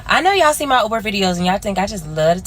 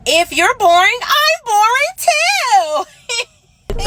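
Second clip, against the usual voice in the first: laughing through the words, high and drawn out; breaking into laughter at the punchline; 12 semitones higher, 3 dB louder, much slower than usual. Real, not acted. Cracking up at own joke.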